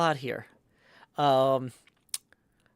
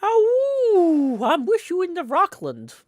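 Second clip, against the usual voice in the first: high, loud mock howl into a yell; howled poem line; 14 semitones higher, 9 dB louder, slower than usual. Riffing on Ginsberg's Howl, howling yell. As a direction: wail the opening, then yell the rest. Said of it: "there's a part where he yells".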